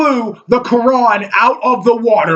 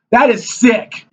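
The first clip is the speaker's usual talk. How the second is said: usual speaking voice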